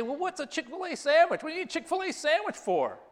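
high, baffled Midwestern asking voice; puzzled locals. Retold or imitated. Chicago congregants asking the pastor, mock baffled and pitched high.

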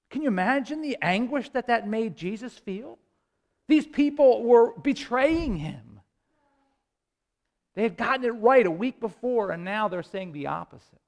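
usual speaking voice

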